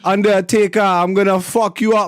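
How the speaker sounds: lightly imitating a wrestler's Jamaican accent; tough-guy Jamaican wrestler bit, lightly done; mock Jamaican accent. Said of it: "So he used to talk like this".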